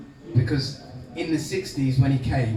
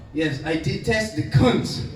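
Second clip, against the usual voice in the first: rough Mauritian accent, self-mocking imitation; old Mauritian uncle in a Mauritian accent; 5 semitones higher, slower than usual. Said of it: "my atrocious Mauritius, Mauritian accent".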